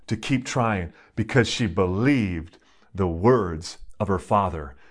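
ordinary talk, nothing marked

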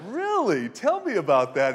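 mock amazement. Pastor humouring the boastful boy, in mock amazement. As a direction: fake wide-eyed wonder to humour him. Mock-impressed reply to kid.

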